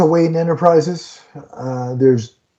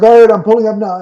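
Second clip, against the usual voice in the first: Bruce pulling up; 6 semitones higher, 4 dB louder, faster than usual. Retold or imitated. Bruce on phone, quoted.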